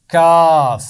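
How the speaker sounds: کاف said loud and drawn out, with a long aa; letter name for children to repeat. Shown as a demonstration. Teacher models کاف loudly for the children to repeat. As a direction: call out کاف clearly, like a model for the class. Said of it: "ایک دفعہ سب مل کر پڑھیں".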